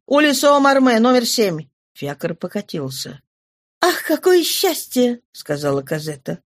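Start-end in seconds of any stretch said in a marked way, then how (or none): 0.1-1.7 raised, higher call. Acted. Calling the address to coachman. 3.8-5.2 higher, delighted exclamation; joyful. Acted. Cosette's joyful cry.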